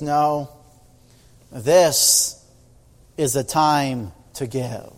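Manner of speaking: low, slow, settled answer; gently but firmly refuse; calm firm reply. Acted.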